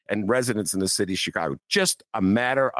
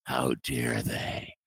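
much softer, very slow mock indignation; a low, slow, quiet put-on fury; hammed-up mayor taking offence; mock outraged mayor; 9 semitones lower, 7 dB quieter, much slower than usual.

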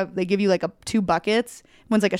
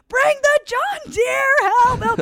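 much higher, louder, stretched calls; screaming for help; 4 dB louder, slower than usual. Retold or imitated. Calling up to the house on the hill, high panicked yell. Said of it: "screaming for help".

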